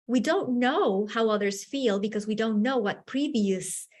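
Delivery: usual speaking voice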